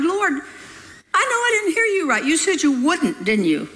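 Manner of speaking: high-pitched start, settling as it goes; astounded. A narrator's reading. Astounded believer questioning the Lord, high protesting disbelief. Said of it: "That came as a real shock to me. It astounded me".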